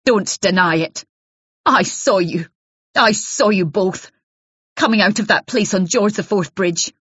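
hostile accusing wife. Acted. Jealous wife warns Isabel off her husband, raw and hostile.

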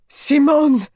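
high, loud call of a name; desperate cry. A narrator's reading. Raoul rushing to the curtain, crying out for Simone. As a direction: shout for her in alarm. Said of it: "cried Raoul".

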